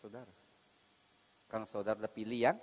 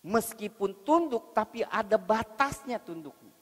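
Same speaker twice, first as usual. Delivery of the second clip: preaching at full force; 11 semitones higher, 16 dB louder than usual. Acted. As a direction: hammer the point home from the pulpit.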